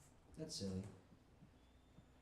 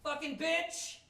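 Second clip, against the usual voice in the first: screeched far higher, loud and slow; cursing old abuelita; 5 dB louder, slower than usual. Retold or imitated. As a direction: screech it like a furious old grandma. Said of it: "she likes to say things like".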